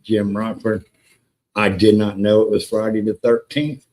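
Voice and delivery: ordinary talk, nothing marked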